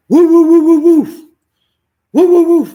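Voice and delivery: high loud howling dog barks; loud, high imitated police dog baying; dog barking imitation. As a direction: howl and bark like a hunting dog.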